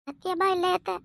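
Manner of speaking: softly begging, voice held down; soft pleading. Acted. Daughter-in-law begs her mother-in-law, soft and pleading. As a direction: plead gently, afraid to anger her.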